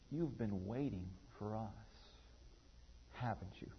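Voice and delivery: tender hush. Retold or imitated. Mother-to-be speaking softly to the child's photo. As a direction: breathe it gently to the picture.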